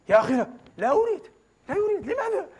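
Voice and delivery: squeaky, rising mock voice of a stingy rich man; mock refusal. Preacher mimicking a rich man who refuses to donate, whiny high mock voice. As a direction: mimic the miser whining no.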